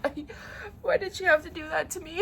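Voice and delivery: high, teary plea; near tears. Real, not acted. Blaming the author for the ending, voice breaking. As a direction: let the voice crack toward crying. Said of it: "I need to pull it together".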